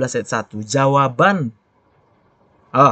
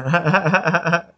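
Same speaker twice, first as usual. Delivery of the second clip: amused laugh; 3 semitones higher than usual. Real, not acted. Doctor admits recording first, amused laughing. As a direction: run of quick chuckles.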